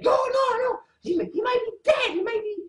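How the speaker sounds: high mock fretting voice; hammed-up anxious wife; panicking woman. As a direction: squeal it like a worried wife. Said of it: "a woman would be like".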